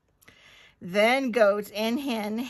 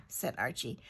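plain reading voice

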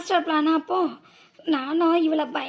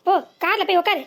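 ordinary delivery

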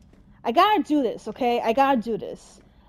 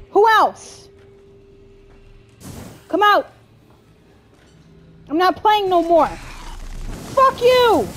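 high, loud, panicky yelling; furious fighting shout; 6 semitones higher, 6 dB louder, slower than usual. Real, not acted. Player not playing any more, furious shouting. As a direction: yell challenges and curses at attackers. Fighting enemies while angry.

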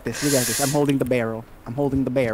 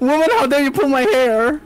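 high-pitched indignant protest; mock outrage; 8 semitones higher, 3 dB louder than usual. Real, not acted. Player scolds friend for pulling hair, mock outrage.

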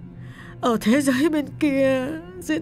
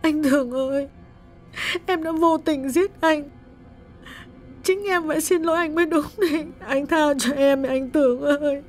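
sobbing plea. A narrator's reading. Widow sobs to her dead husband's spirit, sobbing. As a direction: beg forgiveness through sobs. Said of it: "bật khóc lớn và nức nở nói".

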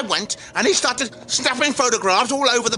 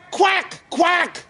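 two short quacks, pitched up; mock duck quack; 4 semitones higher than usual. Imitates the duck he sees.

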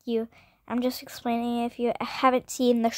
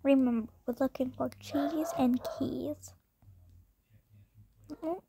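whispering; 5 dB quieter than usual. Real, not acted. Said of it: "My dad's on a meeting".

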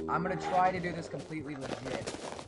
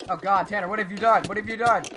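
loud, raised dismayed scolding; alarmed outcry; 4 semitones higher, 12 dB louder than usual. Real, not acted. Player scolds teammate over a mishap, loud and alarmed.

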